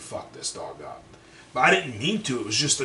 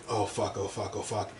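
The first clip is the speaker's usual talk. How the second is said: muttered panic; 5 semitones lower, 9 dB quieter than usual. Retold or imitated. Panicking alone in the van.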